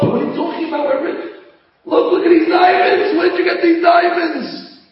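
high, loud, breathless delight; overjoyed shouting. Acted. Wife shows her husband the diamonds, overjoyed shouting. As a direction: gush over the diamonds.

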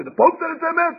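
high, loud disbelieving question; can't believe it; incredulous. Retold or imitated.

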